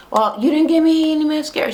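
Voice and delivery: high-pitched mock complaint; put-on petulant grievance; complaining. Retelling friend's whiny complaint.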